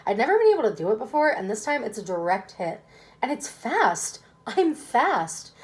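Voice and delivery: usual speaking voice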